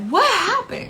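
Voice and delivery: high, urgent question; retold panic. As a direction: relive the alarmed demand to know. Reliving panicked phone questions.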